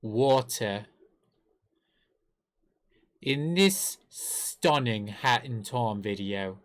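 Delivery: slow, emphatic attempt at British; measured fake British, each word set apart; slowly over-enunciate in a British accent. Shown as a demonstration. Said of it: "that's kinda British too".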